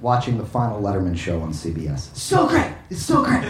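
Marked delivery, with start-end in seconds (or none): 2.1-3.4 Jimmy Fallon impression. Jimmy Fallon reacting to Letterman.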